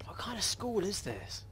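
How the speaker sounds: hushed whisper, breathy laugh first. Real, not acted. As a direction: whisper nervously. Whispering while sneaking in horror game.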